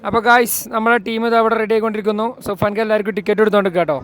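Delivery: loud, pumped-up vlog voice; energetic. Real, not acted. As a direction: turn the energy way up. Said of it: "നമ്മൾ energetic ആയിട്ടില്ല എന്നുള്ളത്".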